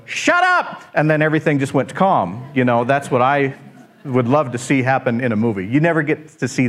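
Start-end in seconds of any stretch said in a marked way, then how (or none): 0.1-0.8 high, sharp mock yell; grumpy shout. Jesus woken in the boat yells at the storm, mock shout. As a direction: snap it out like someone rudely woken up. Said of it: "we'd wake up and just go".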